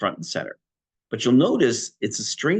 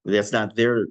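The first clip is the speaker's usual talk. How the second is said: ordinary talk, nothing marked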